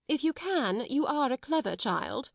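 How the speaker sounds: high, tart reply; sharp asperity. A narrator's reading. Miss Minchin answering Sarah's request, with asperity. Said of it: "chilled by her asperity".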